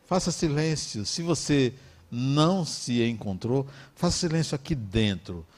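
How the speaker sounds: ordinary talk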